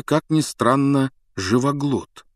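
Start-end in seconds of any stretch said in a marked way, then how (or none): none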